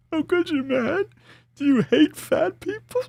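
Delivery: very high, squeaky fake hurt; mock-wounded squeal. Podcaster pretending to be hurt by fatphobia, high mock squeal. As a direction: squeal it in exaggerated distress.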